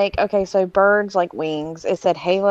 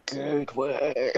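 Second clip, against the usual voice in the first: slow, lower and softer mock ghost voice; imitating the creepy voice; 8 semitones lower, 9 dB quieter, slower than usual. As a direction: drag the word out low and soft like a spirit box. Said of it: "It was like".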